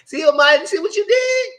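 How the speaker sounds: high-pitched, hammed-up disbelief; mock astonishment. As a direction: exclaim it way up high, playfully scandalized. Acts out astonished reaction to Maya.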